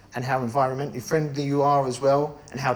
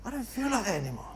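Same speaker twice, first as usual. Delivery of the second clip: soft high aside; 7 semitones higher, 8 dB quieter, faster than usual. Shown as a demonstration. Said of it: "on Monday, you're feeling like that".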